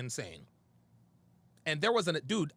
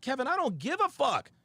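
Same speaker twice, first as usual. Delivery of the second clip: higher, louder defiant snap at Kevin; heated retold outburst; 8 semitones higher, 4 dB louder than usual. Retelling the argument to Kevin, a heated defiant outburst. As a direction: throw it back at him, defiant.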